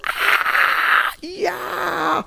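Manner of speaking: high, shouted cheer of a crowd; crowd roar. Retold or imitated. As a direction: roar like a concert audience.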